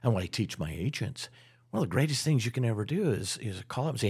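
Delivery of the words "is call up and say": ordinary talk, nothing marked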